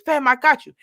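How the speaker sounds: loud hype-man call across the street. Retold or imitated. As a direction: shout it like hyping your boy.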